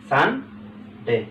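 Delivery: Sunday drawn out very slowly as সান and ডে; slow syllable demo. Shown as a demonstration. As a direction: stretch Sunday so both syllables stand apart. (Sunday খুব ধীরে টেনে টেনে বলা)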